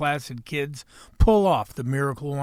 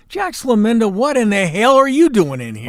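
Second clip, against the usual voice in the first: raised, higher-pitched call-out; loud mock astonishment; 6 semitones higher, 7 dB louder than usual. Real, not acted. Host spotting Jax Laminda in here, loud mock astonishment. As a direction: greet an unexpected guest with loud disbelief. Host calls out surprise guest.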